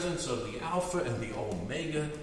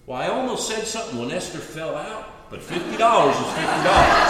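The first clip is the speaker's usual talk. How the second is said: louder at the end, laughing through the punchline; punchline shout with laughter; 8 dB louder than usual. Retold or imitated. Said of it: "Morris shouts out".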